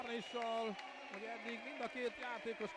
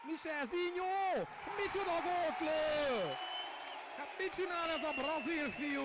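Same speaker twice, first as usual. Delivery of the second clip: excited Hungarian sports commentary; 6 semitones higher, 6 dB louder than usual. Acted. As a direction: marvel at the goal. Live goal commentary.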